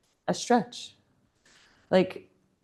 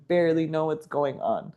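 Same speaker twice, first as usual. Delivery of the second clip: ordinary delivery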